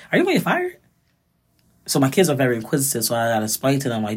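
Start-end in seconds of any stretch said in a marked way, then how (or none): 0.1-0.8 put-on kids' worried question. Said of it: "They was like".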